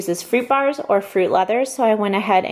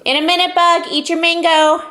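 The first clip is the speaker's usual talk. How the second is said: higher, louder calling out; 9 semitones higher, 4 dB louder than usual. Real, not acted. Calling to child.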